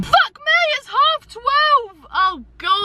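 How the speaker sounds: high, drawn-out cry of alarm; real startled yelp, stretching the words out; shocked at the time. Real, not acted. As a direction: blurt it high in disbelief at the clock. Suddenly sees how late it is.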